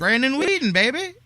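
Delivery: loud, high sarcastic hype call; mock hype. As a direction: hype up the name like a mocking cheer. Mock hype of a failed quarterback.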